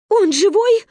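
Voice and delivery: loud, high anxious call; mother's shout. A narrator's reading. Wife calling from the shore, anxious shout. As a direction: shout the question across the water. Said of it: "кричала мама с берега".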